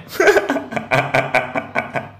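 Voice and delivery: a real, airy burst of laughter; breathy laugh. Real, not acted. Host mocking a political clip, airy laugh. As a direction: laugh under your breath, amused.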